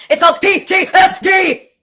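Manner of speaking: shouted and slowed, each letter hit hard; slow, loud, letter-by-letter correction; slow emphatic shout. Real, not acted. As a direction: spell it out loud for the slow ones. Repeating the correct diagnosis, emphatic.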